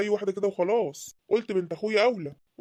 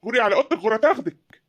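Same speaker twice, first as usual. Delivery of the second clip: زعيق الأب; 4 semitones higher, 7 dB louder than usual. Acted. الأب يطرد بنته بغضب.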